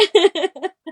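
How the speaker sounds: laughing high. Real, not acted. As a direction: break into laughter. Laughing at the imitation.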